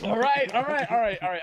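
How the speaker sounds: high, loud, repeated interjection; loudly cutting in. Real, not acted. Taking a tech question over the group's chatter, loudly cutting in. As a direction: talk over everyone to take the floor.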